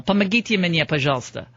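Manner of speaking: Помогите said in Russian with the formal ending, pleading; mock-polite Russian Помогите мне, пожалуйста; formal Russian plea. Shown as a demonstration. Host mocking Obama begging Putin, formal Russian plea. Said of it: "I'd use the formal".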